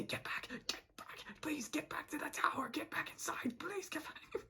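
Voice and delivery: high, pleading stage whisper; whisper-beg him to come back; hammed-up desperate whisper; frantic whisper.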